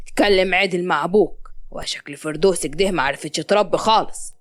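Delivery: low-pitched, lightly voiced scolding father; stern father. Hisham slaps his son and demands respect, stern.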